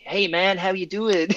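a Scot doing a cheesy fake American "hey man"; terrible American accent. Scot imitating friendly Vegas strangers, in a bad American accent. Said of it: "that's my terrible American accent".

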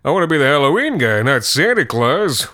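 jolly mock belly boast; hammed-up joke. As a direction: play up the punchline. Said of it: "mime how big his belly".

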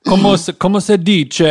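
loud, high mock Italian phrase; switching languages midsentence. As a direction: throw in Italian like a hammy tourist. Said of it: "that's my Zac Efron impressione".

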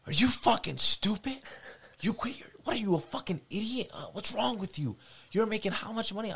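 high, loud mock yell of an enraged old man; furious grandfather. Grandfather berates his grandson for quitting, high furious yell. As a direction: scream at him like a livid grandpa.

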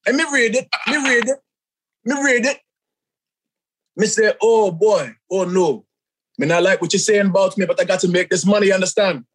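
storytelling in Jamaican patois; Jamaican accent. Acted. Wrestler telling of his hate mail, Jamaican accent. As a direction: recount it in a put-on Jamaican accent. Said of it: "give it to Jamaican style".

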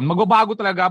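ordinary delivery

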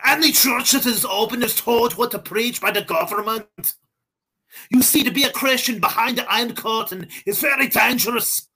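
put-on high-pitched, Germanic-sounding Russian accent; botched Russian accent. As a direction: plead in a hammed-up Eastern European accent. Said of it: "Kozlov needs the Russian accent".

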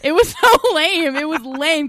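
high, slow, drawn-out scorn; mock disgust. Real, not acted. Mocking the lame scene.

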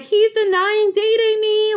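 high, drawn-out retold wail; mock crying wail. Crying teen phones best friend about boyfriend, mock tearful wail. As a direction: wail it slowly, imitating a tearful phone call. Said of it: "like, crying".

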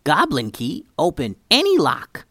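a hint of a sly goblin. Goblin character voice.

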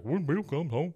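sing-song mimicry of Hank's threat; mocking imitation. [name] mocks Hank's threat, teasing mimic voice. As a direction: parrot him back to needle him. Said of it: "the guy starts making fun of Hank".

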